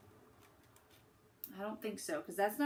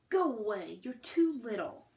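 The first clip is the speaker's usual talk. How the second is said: louder, annoyed big brother; scowling brush-off; 5 dB louder than usual. A narrator's reading. Big brother scowling at his little sister. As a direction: shoo her off with a scowl. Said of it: "Felix scowled".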